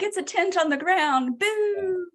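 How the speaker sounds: high, mock-crying complaint; mock whine. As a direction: whine like a sulking camper. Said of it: "Selena's like".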